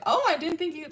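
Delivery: high-pitched surprised greeting; retold surprise, pitch jumping up at the start; shocked. She greets him showing up at the coffee shop, shocked. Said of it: "she's, like, shocked".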